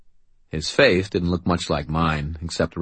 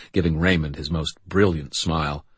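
ordinary delivery, nothing marked

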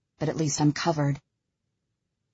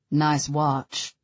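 understated dry remark; 3 semitones lower than usual. A narrator's reading. Callum noting Ella's watch, mildly dry. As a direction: comment with a touch of dryness. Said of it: "he comments dryly".

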